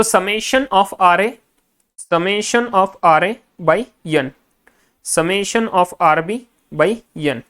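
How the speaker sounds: very slow, each word spelled out for students' notes; slow dictation. Shown as a demonstration. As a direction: dictate it so the class can write along.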